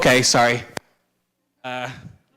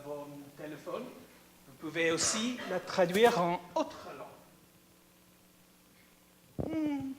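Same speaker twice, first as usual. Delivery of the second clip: French in a non-native accent, often higher-pitched; bad French accent; 3 semitones higher than usual. Shown as a demonstration. Presenter testing live translation for the audience, bad French accent. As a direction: speak halting French with a foreigner's imperfect accent. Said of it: "bad French accent".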